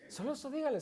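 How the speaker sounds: usual speaking voice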